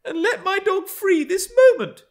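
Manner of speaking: put-on imperious high, loud girl; exclaiming. A narrator's reading. Young Cathy ordering the woman about, high loud demand. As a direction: demand it at once in a child's voice. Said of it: "she exclaimed".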